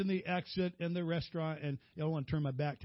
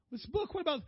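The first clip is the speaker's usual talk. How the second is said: high-pitched, baffled questioning; incredulous wife; 11 semitones higher, faster than usual. Retold or imitated. Wife asks her tearful husband about his book, baffled.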